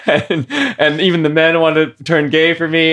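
high, loud, giggly telling; amused, laughter breaking in between phrases; laughing while telling. Real, not acted. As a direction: tell it through bursts of laughter.

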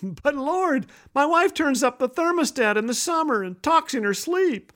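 high, whiny-husband protest; mock complaint. Husband to the Lord about wife, high mock complaint.

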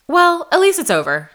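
high, loud mock brush-off; exaggerated dismissive chirp. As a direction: imitate a friend breezily waving it away. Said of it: "Like, just so dismissive".

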